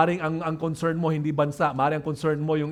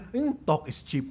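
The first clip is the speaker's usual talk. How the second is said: softer, understated dismissive remark; dismissive critic; 3 semitones lower, 6 dB quieter than usual. Acted. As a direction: brush it off with a low-key "hmm".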